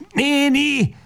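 very high, beside himself; frantic cry. A narrator's reading. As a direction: scream the word in despair. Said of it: "kiáltja magán kívül". (magán kívül kiáltva)

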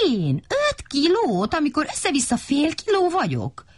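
high-pitched affronted little character; indignant crow character. A narrator's reading. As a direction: squawk it in high disbelief, stretching the key words. Indignant siren-crow answers about her weight.

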